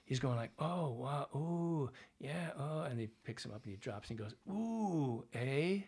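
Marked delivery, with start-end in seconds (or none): 0.6-2.8 higher Midwestern oohs and wows; mock Chicago wrestling fan. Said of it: "the actor watching it goes". 4.5-5.8 high "Ooh, eh?" with a misplaced Canadian eh; wrong Canadian eh. Retold or imitated. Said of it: "used it in the one way that we don't use it".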